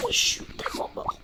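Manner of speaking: defeated whisper. Real, not acted. Player losing a race, whispering in defeat. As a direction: breathe it out, beaten.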